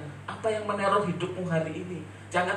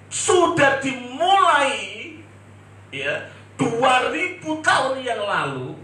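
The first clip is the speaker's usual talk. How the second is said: slow, loud, higher preaching; full-force preaching; 6 semitones higher, 6 dB louder, slower than usual. Acted. Preacher proclaiming prophecy to congregation, slow loud preaching. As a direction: draw each phrase out grandly.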